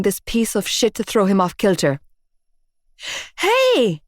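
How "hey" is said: high shouted hey; narrated scream. A narrator's reading. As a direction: yell it across the car park. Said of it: "I screamed".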